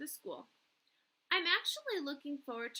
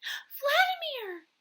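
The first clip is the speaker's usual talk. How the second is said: high, loud, stretched-out cry of joy; overjoyed cry; 9 dB louder, much slower than usual. Acted. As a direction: cry his name in delight. Sees lost pet.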